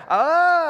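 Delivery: loud, high mock wail; hammed-up freak-out "ah"; panicked scream. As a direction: cry out in comic panic. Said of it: "and he's freaking out".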